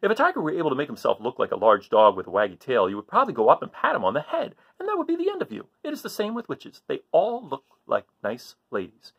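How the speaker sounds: ordinary delivery, nothing marked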